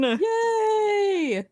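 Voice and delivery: high delighted shout; excited cheer. Real, not acted. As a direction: cheer like you just won. Gets to play Jean.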